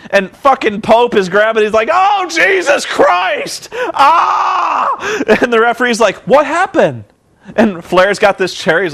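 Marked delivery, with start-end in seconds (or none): 1.9-5.2 high, drawn-out mock howl of pain; hammed-up agonised scream. Acting wrestler howling in pain. 6.3-7.0 high, startled question; hammed-up innocent surprise, pitched up; mock bewildered referee. Acting the oblivious referee.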